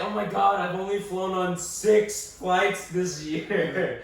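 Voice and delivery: high, loud, slow bragging whine; mock spoiled traveler. Mocking a frequent flyer's complaint, high slow whine. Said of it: "I was like".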